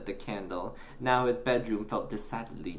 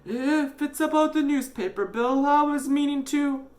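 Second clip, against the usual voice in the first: high, strained voice; apologetic dad; 6 dB louder than usual. Acted. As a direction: start excusing yourself over a bill.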